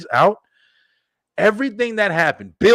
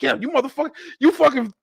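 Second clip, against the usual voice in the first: wrestler's taunt; 9 semitones higher than usual. Retold or imitated.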